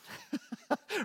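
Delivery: high delighted laugh. Retold or imitated. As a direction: laugh in giddy relief. Joyful disbelief at the check.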